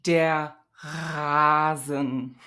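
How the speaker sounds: slow Rasen with a voiced uvular fricative R. Shown as a demonstration. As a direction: rasp the R at the back of the throat in Rasen. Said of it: "I've got some examples for you".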